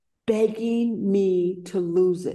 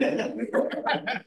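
light laugh; 4 dB quieter than usual. Real, not acted. Laughing at the family's stares.

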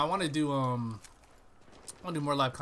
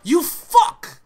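very loud, high furious yell; 14 semitones higher, 16 dB louder than usual. Real, not acted. Gamer yelling after being killed, very loud and high. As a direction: explode at the screen.

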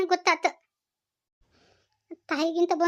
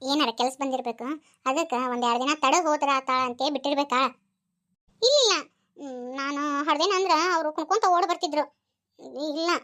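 ordinary talk

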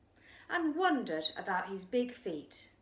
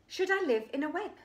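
put-on childlike questioning; 8 semitones higher, 4 dB louder, faster than usual. Child character asking excitedly.

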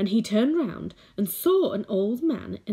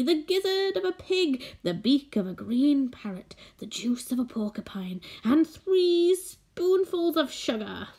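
high, hammed-up old man reciting a recipe, slipping to whispers; gleeful sorcerer gloating over each ingredient; excited old wizard; 6 semitones higher than usual. A narrator's reading. Old man tells the boy the magic recipe, gleeful and high.

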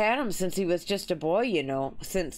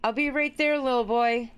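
lightly voiced game character, higher; a hint of an eager shopkeeper calling out; light character voice for dialogue; reading a character's lines; 7 semitones higher than usual. Reads game character's lines aloud.

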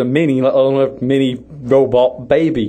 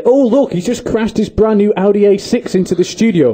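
raised, put-on excited announcement; mock commentary; 5 semitones higher than usual. Host pretending a car crashed into the studio, mock excited plug. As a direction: exclaim it like a shameless product plug. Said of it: "I'm allowed to say".

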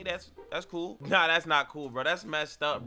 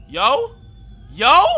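startled shout, higher and louder; alarmed shouting; 8 semitones higher, 13 dB louder than usual. Real, not acted. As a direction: call out sharply in alarm. Startled by something in video.

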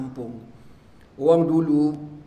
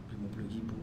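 "Lima puluh ribu?" softer, rising in disbelief; disbelieving echo; 6 semitones lower, 14 dB quieter, faster than usual. Retold or imitated. Preacher echoes friend's fifty thousand, stunned softly.

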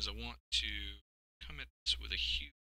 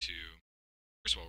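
normal talking voice

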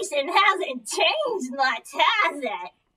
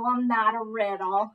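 ordinary delivery

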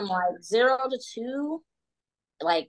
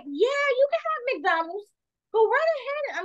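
too-sweet permission; 14 semitones higher than usual. Retold or imitated. Imitating mommy baiting the daughter with McDonald's, high and over-sweet.

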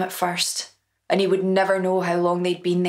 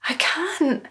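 put-on high small boy's voice on the edge of tears; tearful boy; 8 semitones higher than usual. A narrator's reading. Humiliated son begging his mother, high and tearful. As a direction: plead like a frightened child.

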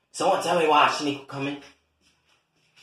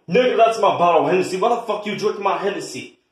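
fast, loud angry outburst; furious; 7 semitones higher, 4 dB louder, faster than usual. Acted. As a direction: blow up over the stolen bottle. Catches someone drinking his Hennessy.

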